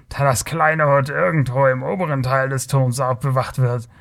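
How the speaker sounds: self-important character voice, schooling a naive youth; lecturing Kothian rogue. Acted. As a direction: hold forth as the condescending old hand. (belehrender, herablassender Kotier)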